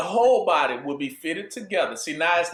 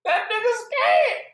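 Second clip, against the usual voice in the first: higher, slow, drawn-out punchline; high mocking repeat. Shown as a demonstration. Preacher repeating the punchline to a laughing crowd, high and slow. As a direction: stretch it out with amused mockery.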